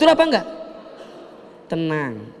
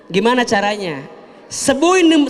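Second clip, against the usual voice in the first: normal talking voice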